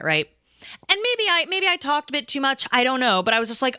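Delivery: ordinary talk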